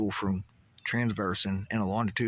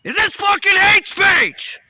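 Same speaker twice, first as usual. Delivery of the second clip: slow, much higher and louder enraged yell; angry shout; 14 dB louder, slower than usual. Shown as a demonstration. Ranter demonstrates anger modulation by screaming furiously. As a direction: blast "hate" with rage. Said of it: "Can you sense the anger modulation?".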